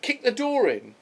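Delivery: high, eager command; urgent order. Retold or imitated. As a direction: tell him to kick it in, fired up. Paul orders door kicked.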